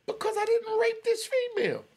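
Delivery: very high-pitched, incredulous outrage; indignant protest. Real, not acted. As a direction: voice shoots right up in protest. Indignant about being hammered for refusing.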